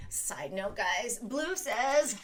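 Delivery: ordinary talk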